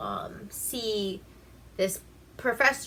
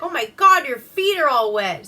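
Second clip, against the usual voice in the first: higher, louder startled cry; shocked wife; 6 semitones higher, 10 dB louder than usual. Retold or imitated. Wife startled at her husband's soaked feet, loud surprise. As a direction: gasp at his wet feet. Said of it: "she's like".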